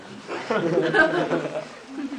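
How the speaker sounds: high, helpless laugh; real laughter. Real, not acted. Teller breaks up laughing.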